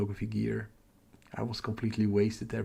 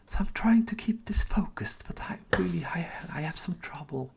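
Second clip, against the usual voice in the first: whisper; 8 semitones higher, 3 dB louder than usual. Real, not acted. Confessing trouble staying focused on stream.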